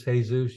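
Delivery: "Jesus" said the Spanish way, hay-SOOS. Shown as a demonstration. Teacher to student, "Jesus" as Spanish hay-SOOS. Same name repeated, Spanish sound.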